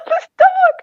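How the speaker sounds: words squeezed out through laughter; laughing. Real, not acted. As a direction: laugh it out, high and breaking. Laughing while telling funny story.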